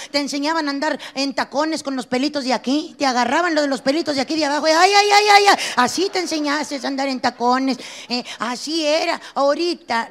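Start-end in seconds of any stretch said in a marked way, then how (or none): 4.7-5.2 high loud mock cries of pain; hammed-up ouch cries; mock pained yelps. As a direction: yelp like your hair is yanked. Pained cries while hair pulled.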